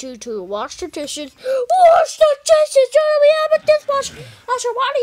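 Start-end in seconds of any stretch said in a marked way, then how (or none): 1.4-2.9 high, loud, slow crying yell; outraged shriek. Acted. Kid protesting mom's order to wash dishes, wailing outraged shriek. 2.9-5.0 high, loud, fast indignant yell; outraged protest. Acted. Kid yelling at parent that there is a dishwasher, indignant high shout.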